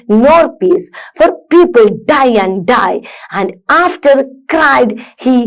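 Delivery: slow rocking rhythm, "rest", "joy", "peace", "die" stressed; teacher's model recital, each beat like "cried he" set apart and lifted; stressed poem recitation. Shown as a demonstration. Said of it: "stress ഒക്കെ കൊടുത്ത് കൊടുത്ത് വേണം". ("rest", "joy", "die", "body" ഊന്നി ഈണത്തിൽ കവിത ചൊല്ലൽ)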